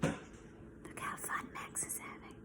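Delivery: hushed aside; whisper. Acted. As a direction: whisper it with delight.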